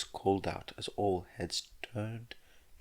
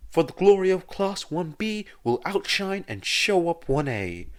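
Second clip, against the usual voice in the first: much louder, grandiose boast; loud proclamation; 5 semitones higher, 6 dB louder than usual. A narrator's reading. Monoma boasting to Professor Egon about his class. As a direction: proclaim it like a victory speech. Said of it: "loudly proclaims while laughing".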